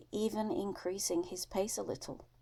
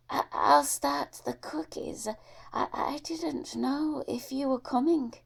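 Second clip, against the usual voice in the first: put-on a higher, airy old woman's welcome; sweet old lady; 7 semitones higher, 6 dB louder than usual. Old Missus Drew welcoming Bubba at her door, higher fond voice. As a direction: coo a fond greeting to the boy.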